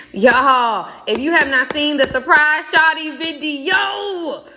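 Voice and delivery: high, drawn-out excited gushing; hyped-up, pitch climbing to the end; excited hype. Real, not acted. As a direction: gush to the viewers, stretching it out high. Hyping her video to viewers.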